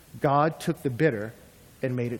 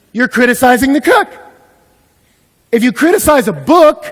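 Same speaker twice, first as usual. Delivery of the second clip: louder, driving sermon voice; full-force preaching; 10 semitones higher, 14 dB louder than usual. Acted. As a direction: preach it hard to the room. Preaching climax about the Maker.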